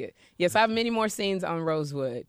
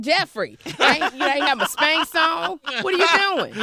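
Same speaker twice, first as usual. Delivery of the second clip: louder and higher, a mock panicked yell at her son; panicked scolding; 8 semitones higher, 5 dB louder than usual. Mother caught on camera yells at her son, hammed-up panic. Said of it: "I'm like".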